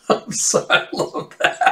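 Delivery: words breathed out through real laughter; can't hold it together, laugh through it; laughing apology. Real, not acted. Breaks up laughing mid-voice.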